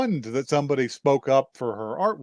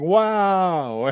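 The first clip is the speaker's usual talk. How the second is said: higher, mildly acted "wow"; admiring wow; 6 semitones higher, 4 dB louder than usual. Parents react to her childhood mural design, admiring wow. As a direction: voice amazed parents, lightly.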